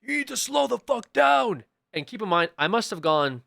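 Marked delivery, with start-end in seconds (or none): 0.0-1.6 high, drawn-out shouted order; screaming cop. Retold or imitated. Cop pulling a driver over, screaming. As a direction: yell it slowly, imitating an irate officer. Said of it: "he starts screaming at me".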